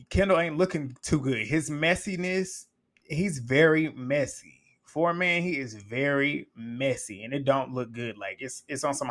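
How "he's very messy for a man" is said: usual speaking voice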